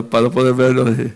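usual speaking voice

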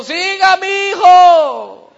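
very high, long-drawn shout from afar; imitated grandfather hollering through the house; hollered welcome. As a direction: call out loudly, stretching the last word. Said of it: "escuché una voz que dijo".

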